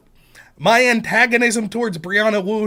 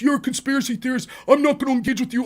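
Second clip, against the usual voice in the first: whiny mocking voice, higher; a dismissive online opponent, mocked in a mildly higher whine; mocking impression of her; 5 semitones higher, 4 dB louder than usual. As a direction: sneer out her lines in a put-on high voice. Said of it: "She just says".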